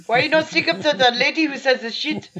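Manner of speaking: high, huffy imitation of the actor; mock French actor. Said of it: "That was [name] speaking French".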